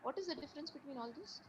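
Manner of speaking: very faint, far from the mic; barely audible. Real, not acted. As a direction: voice almost gone, barely there. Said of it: "your voice is very, uh, very faint".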